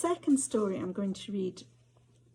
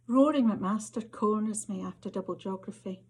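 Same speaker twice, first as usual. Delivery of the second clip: measured, intimate Scottish storytelling read; story narration. A narrator's reading. Author reading to an audience, story narration. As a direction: author reading her flash fiction aloud, unhurried and close. Reads second story aloud.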